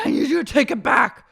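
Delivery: high, loud insistence; mock heated demand. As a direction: demand it loudly, imitating an angry housewife. Acting out demand in argument.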